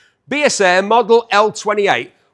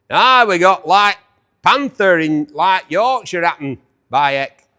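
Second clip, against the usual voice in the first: hammed-up Yorkshire accent, loud to start; 6 dB louder than usual. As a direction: show off a mock Yorkshire accent. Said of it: "What do you think of my Yorkshire accent?".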